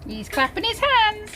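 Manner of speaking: usual speaking voice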